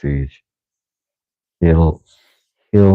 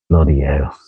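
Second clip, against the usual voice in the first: mock British accent, slightly low; put-on accent; 3 semitones lower than usual. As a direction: grumble in a fake British accent. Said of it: "you're just gonna get the accent".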